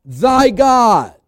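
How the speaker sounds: loud, high-pitched bursts on key words; full-force preaching. Real, not acted. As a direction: preach it with driving emphasis.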